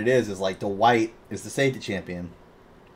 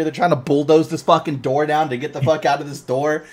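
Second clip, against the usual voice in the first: ordinary talk, nothing marked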